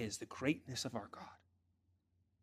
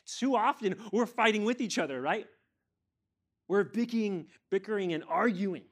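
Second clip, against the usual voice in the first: high, loud, scolding sermon burst; impassioned preaching; 9 semitones higher, 8 dB louder than usual. Real, not acted. Preacher reproving church for fighting each other, impassioned. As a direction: call out the congregation at full force.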